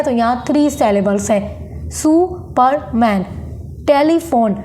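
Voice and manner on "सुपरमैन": सुपरमैन slowly, syllable by syllable; three beats in सुपरमैन with two pauses; split into three syllables. Shown as a demonstration. Teacher counting three syllables, सुपरमैन broken up. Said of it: "यहाँ पर दो बार pause हो रहा है".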